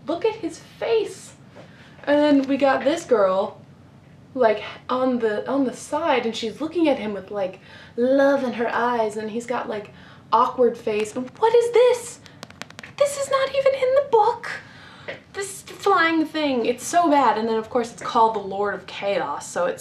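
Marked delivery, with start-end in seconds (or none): 0.1-1.3 much higher, slow disbelief; incredulous squeal. Real, not acted. Showing viewers the cover man's face, incredulous and high. 11.1-17.4 much higher and louder indignation; outraged disbelief. Real, not acted. Ranting to viewers about the awful cover, high and loud.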